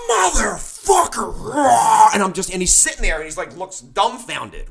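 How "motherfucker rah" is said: reliving a raging yell, voice cracking, pitched up; furious scream. Retold or imitated. Confronting the guitar player over the broken taillight, screaming with rage. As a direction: let out a cracked, enraged roar. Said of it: "I'm, like, screaming".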